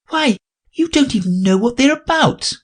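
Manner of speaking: put-on high girlish voice; Alice, high and polite. Alice objects.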